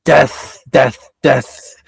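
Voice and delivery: normal talking voice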